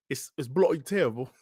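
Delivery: hammed-up, clumsy English accent; bad English accent. Streamer joking with viewers about his terrible accent, bad English accent. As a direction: put on a badly faked English accent. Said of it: "My bad English accent".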